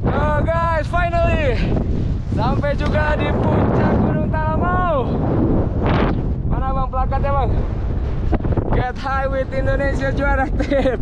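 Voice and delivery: high, loud and thrilled at the summit; excited shout. Real, not acted. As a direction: cheer it out, overjoyed. Reaching the summit at last.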